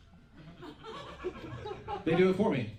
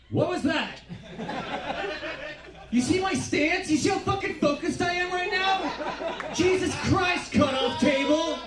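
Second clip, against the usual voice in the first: real excited yelling; 12 semitones higher, slower than usual. Real, not acted. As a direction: call out the noisy table. Heckling rowdy table mid-set.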